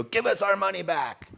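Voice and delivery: high, pushy demand for the money; a hammed-up plea for money back; mock lobby demand. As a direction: play the indignant hospital lobby. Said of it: "the hospital lobby to go".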